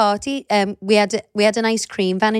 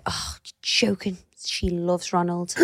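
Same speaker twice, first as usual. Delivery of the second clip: slow, softened mock aside; confiding tease; 3 semitones lower, 8 dB quieter, slower than usual. Dad to till teenager about daughter's crush, slow hushed tease. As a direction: retell a dad's drawn-out, hushed teasing. Dad teasing at drive-through window.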